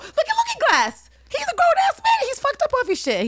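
squealing high and loud with glee; very high excited rant. Real, not acted. Gushing to co-host about Looking Glass in squealing high glee. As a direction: gleefully hype it up, way up high.

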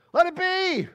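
high, loud [name]; preacher quoting [name], loud and high; emphatic [name]. Retold or imitated. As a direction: belt the second [name]. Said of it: "In the words of [name]".

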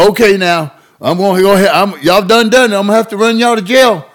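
high, loud hammed-up country cop; small-town cop. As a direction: play the fed-up local cop, loud and high. Cops threaten to jail the boys.

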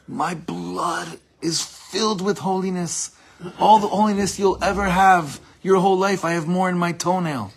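high, loud mock outburst; retold scream. Teacher re-enacting the rabbi screaming at an infiltrator, mock yell. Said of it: "screamed at him".

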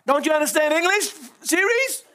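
exasperated question to the phone, pitch rising on Siri's; lightly hammed-up annoyance at Siri; mock exasperation. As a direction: scold the phone in fun. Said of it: "I get so aggravated".

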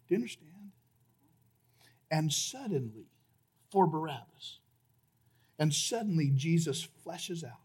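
soft, low, with long pauses; hushed preaching. Real, not acted. Preacher telling listeners the innocent died instead, hushed preaching. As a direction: let each phrase sink in quietly. Hushed, slow sermon climax.

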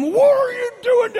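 high, loud barked question; hammed-up furious demand; angry cop. Officer confronting the driver who hit him, loud mock shout. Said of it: "they come".

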